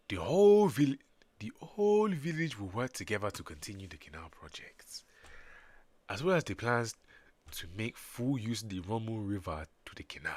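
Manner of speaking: put-on African accent for a village elder. Sumerian elder announcing the canal plans, African accent. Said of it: "So I gotta make them African".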